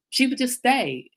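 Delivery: usual speaking voice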